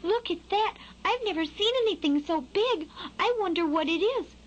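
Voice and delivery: high-pitched wonderstruck fledgling; bewildered. A narrator's reading. Skylark peeking at an enormous animal, high bewilderment. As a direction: marvel in a small bird's voice. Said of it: "struck with bewilderment".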